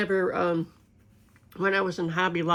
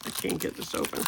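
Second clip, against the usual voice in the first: muttering; 7 dB quieter, faster than usual. Real, not acted. Struggling to open the packet.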